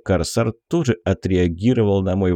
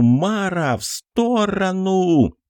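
wild yell; 10 semitones higher, slower than usual. Acted. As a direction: scream it, drawing it out slowly. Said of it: "Диким криком заорал Кокос".

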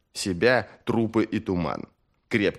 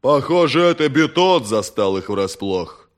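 rumbling voice; 4 semitones higher, 6 dB louder than usual. Acted. Tulduru's voice continues.

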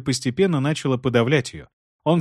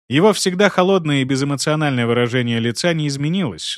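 usual speaking voice

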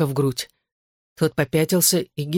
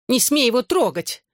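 higher-pitched mild cry; cried warning; 8 semitones higher than usual. A narrator's reading. Woman slapping the friend forbids touching him, mildly shouted. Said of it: "крикнула она".

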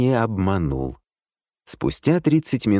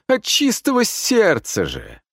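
high, slow wheedling; moaning plea; 13 semitones higher, slower than usual. A narrator's reading. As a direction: insist with a theatrical whine. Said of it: "простонал Вилкин". (жалобно упрашивать)

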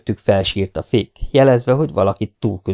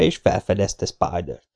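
usual speaking voice

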